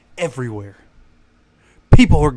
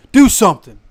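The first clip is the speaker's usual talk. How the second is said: rising demand; louder, higher repeat; 5 semitones higher, 7 dB louder than usual. Shown as a demonstration. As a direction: Do something shouted up high.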